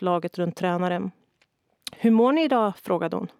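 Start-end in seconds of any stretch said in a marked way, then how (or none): 1.9-2.8 high, loud upbeat greeting; rally the team with energy; cheery coach. A narrator's reading. Coach greets team after warm-up.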